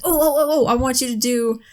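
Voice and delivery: high, excited retold plea; eager interjection. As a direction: burst in eagerly with a request.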